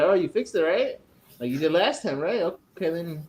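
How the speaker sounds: a touch of sarcasm; retold sarcasm.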